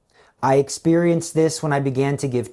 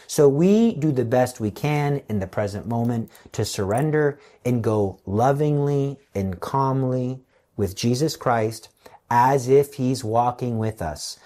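ordinary talk, nothing marked

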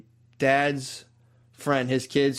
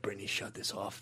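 much softer, urgent hush to his wife; hushed plea; 5 semitones lower, 16 dB quieter than usual. Retold or imitated. Comic to wife as his video plays, hushed and urgent. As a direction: keep it down so the guests can't hear.

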